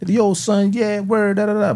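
high, mock Brooklyn street talk; imitating a Brooklyn slang voice, pitched well up; a Jamaican coworker talking Brooklyn, high; Brooklyn accent. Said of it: "but they from Brooklyn".

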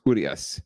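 "Urias" said OO-dee-as; stress on U. Shown as a demonstration. Co-host settles the pronunciation, stress on U. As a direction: lean on the first syllable of "Urias", then drop. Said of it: "the accent is on the U".

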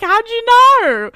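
high, loud, slow squeal; mock caught-out. Busted by her therapist, high slow squeal. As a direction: stretch it out high and loud like you've been caught.

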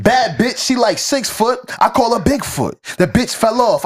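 lightly voiced twitchy crackhead read. Shown as a demonstration. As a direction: rap it like a jittery crackhead. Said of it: "I'm trying to read in crackhead, uh, tone is hard".

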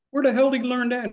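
high, fast, stunned outburst; astonished exclamation. Retold or imitated. To the kid's dad about his son's playing, high and fast disbelief. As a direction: blurt it out in disbelief.

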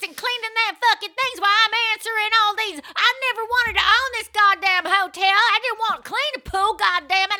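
shrill high angry rant, loud; ranting motel owner. Pretend.

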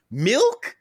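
questioning surprise. A narrator's reading. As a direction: read it as a baffled question. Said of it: "Question mark".

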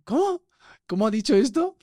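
high, puzzled ¿Cómo? rising sharply; imitated confusion. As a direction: act baffled at fast slang, voice shooting up. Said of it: "Como dices".

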